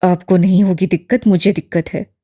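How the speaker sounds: lightly voiced young man, annoyed; a touch of irritation; irritated young man. A narrator's reading. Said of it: "नागवारी से कहकर".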